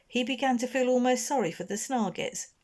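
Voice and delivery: ordinary delivery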